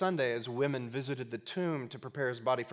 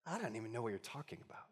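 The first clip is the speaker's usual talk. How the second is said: quick, much softer acted denial; hushed denial; 3 semitones lower, 13 dB quieter, much faster than usual. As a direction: brush it off under your voice, imitating. Peter denies it, retold.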